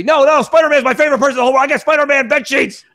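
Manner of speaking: imitating a rabid superfan; mock fanboy gushing. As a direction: play an overexcited fan who won't hear criticism. Mimicking an overexcited Spider-Man fan.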